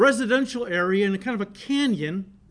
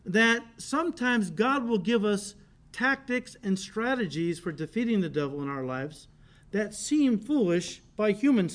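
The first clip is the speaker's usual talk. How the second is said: ordinary delivery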